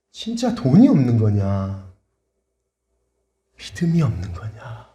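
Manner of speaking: slow, probing question growing hushed; the Lord. Retold or imitated. The Lord tests the debtor's faith, slow and hushed. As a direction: press slowly, letting the voice sink. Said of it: "근데 주님이".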